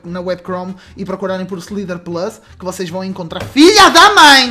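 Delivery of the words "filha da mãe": angry outburst. Real, not acted. Killed again, swearing.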